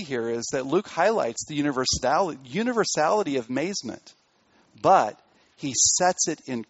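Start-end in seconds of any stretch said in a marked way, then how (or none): none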